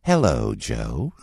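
low, cold two-word greeting; icy Nancy Reagan. Retold or imitated. Greeting the comedian who mocked her husband, low and frosty. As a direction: chill him with the hello, dropping to a hush. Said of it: "Two words".

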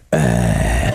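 very deep drawn-out grunt; Ben Affleck. Retold or imitated. Host mocks the actor's superhero voice, deep grunt. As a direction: imitate a superhero's low groan. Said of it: "in his voice. He goes".

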